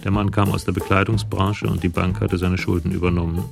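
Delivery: normal talking voice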